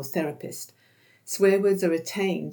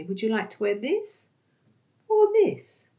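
higher, rising on the second option; offering two choices; 5 semitones higher, 4 dB louder than usual. Shown as a demonstration. Carer holding up two outfits, asking brightly. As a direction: hold up each outfit and ask brightly.

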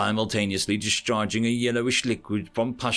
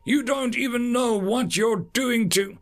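high, insistent alien lecture building up; a lightly voiced, upset alien; agitated alien; 8 semitones higher than usual. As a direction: lecture her like an anguished creature. Chorus lectures in distress.

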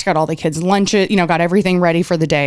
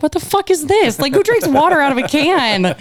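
high, incredulous squeal; mock outrage; 10 semitones higher than usual. At the dinner, mocking the canned water, high and incredulous. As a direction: play up the disbelief at canned water. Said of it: "and I was like".

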